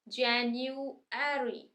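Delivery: January slowly, with "e" as in "air" before -ry; American pronunciation. Shown as a demonstration. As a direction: say January as JAN-yoo-air-ee. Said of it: "अ के बजाय ए sound है". (January में अ की जगह ए, American उच्चारण)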